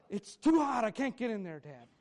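put-on loud, high cry of a balking kid; protesting child. Son refusing to get in the hot car, loud protest.

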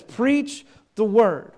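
slow emphatic "Preach the word"; emphasized phrase. Shown as a demonstration. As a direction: lean hard on "Preach the word". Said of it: "You notice the words I emphasize".

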